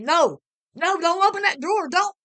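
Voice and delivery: high loud warning shout. A narrator's reading. Husband shouting to stop his wife opening the drawer, a high loud yell. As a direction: yell to stop her in time. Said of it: "and shouted".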